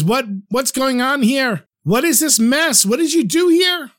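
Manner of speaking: high-pitched, climbing dismay; indignant outburst. A narrator's reading. Innkeeper confronting the drunk guys over the mess, indignant. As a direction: demand answers, pitch rising with each question.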